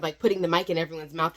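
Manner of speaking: normal talking voice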